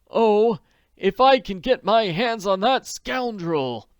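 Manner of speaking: high, loud, slow furious outburst; gritted. A narrator's reading. Jacob Aborn rages at the scoundrel, high and loud. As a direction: spit it out through clenched teeth, high and loud. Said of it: "Jacob Aborn gritted".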